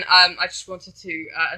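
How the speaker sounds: usual speaking voice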